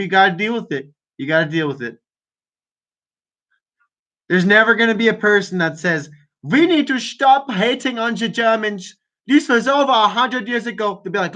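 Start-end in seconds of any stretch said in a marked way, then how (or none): 6.4-11.0 higher-pitched mock German accent; hammed-up German-accented appeal. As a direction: plead in a put-on German accent. Voices an imagined defender of Germans.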